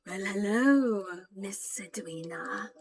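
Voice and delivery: sly snake voice dropping to a hiss; snake shopkeeper. Acted. Snake shopkeeper welcoming Edwina, sly hissing voice. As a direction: hiss the name, then oily and welcoming. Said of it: "the snake will look at Edwina and says".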